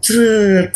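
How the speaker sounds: long high "sreeet"; imitated whooshing sound. Telling how it slid into the banana tree, imitated whooshing sound. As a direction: draw out a high-pitched swoosh.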